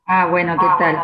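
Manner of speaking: audio echo feeding back. Real, not acted. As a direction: voice returning through an open mic. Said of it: "porque se escucha el eco, el retorno".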